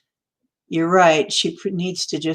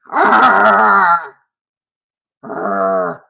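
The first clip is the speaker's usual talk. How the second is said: Bigfoot roar; 3 semitones higher, 8 dB louder than usual. Pretend. Vlogger roars at viewers in a playful Bigfoot impression. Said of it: "That was my Bigfoot impression".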